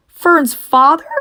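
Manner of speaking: high, loud sobbing question; sobbing disbelief. Acted. Wilbur learns Fern's father will kill him, sobbing. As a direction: cry it out in horror. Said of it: "sobbed Wilbur".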